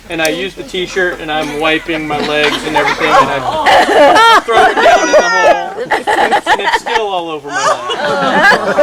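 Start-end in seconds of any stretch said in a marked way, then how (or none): 3.1-8.8 high, slow words broken by laughter; losing it while telling; helpless laughter through the story; laughing hard. Real, not acted.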